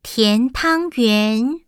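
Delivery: slow teacher reading. Shown as a demonstration. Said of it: "现在老师念一句，小朋友再跟着念".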